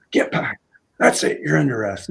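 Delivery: curt mock police command; retold cop's abrupt order; imitated cop arrest. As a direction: act out an officer making a snap arrest.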